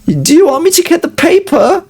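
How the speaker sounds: mock the wife's offer in a high voice. Husband-wife scene retold.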